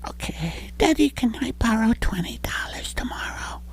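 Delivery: put-on high little boy whisper; whispered child's plea; kid whisper.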